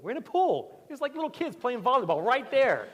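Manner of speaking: high loud amused exclamation; amused outburst. Retold or imitated. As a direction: burst out at how shallow the pool was. Laughing at the shallow pool.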